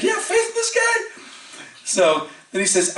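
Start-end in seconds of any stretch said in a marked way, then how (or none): 0.0-1.0 high-pitched mock disbelief; imitating someone who can't believe it; incredulous. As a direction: squeak it out as an astonished question. Acting out disbelief at controversial faith.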